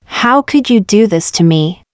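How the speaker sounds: ordinary delivery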